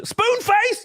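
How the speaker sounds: squeaky high quizzical "Spoon face?"; mock question. Comedian offering a fake slur, high and quizzical. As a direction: pitch it way up like a silly question. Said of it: "They look for".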